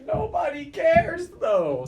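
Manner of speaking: very high, slow, drawn-out dismissal; mock dismissive. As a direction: whine it dismissively. Teasing dismissal.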